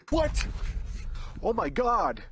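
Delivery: sudden high, loud yelp; startled. Real, not acted. Hunter spooked by a noise behind him, startled yelp. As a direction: jolt out the word in fright.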